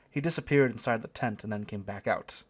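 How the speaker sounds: ordinary delivery, nothing marked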